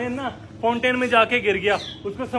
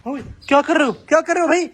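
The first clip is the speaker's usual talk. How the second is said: sharp raised call at the cub; startled scolding shout; 8 semitones higher, 4 dB louder than usual. Real, not acted. Handler shouting at the lion cub to stop biting. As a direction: stop him mid-bite with a loud yell.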